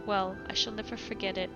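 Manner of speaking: usual speaking voice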